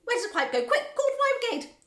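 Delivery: high, loud frantic yelling. A narrator's reading. Mother demanding the fire brigade, yelling. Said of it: "yelled Missus Gloop".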